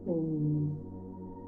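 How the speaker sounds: usual speaking voice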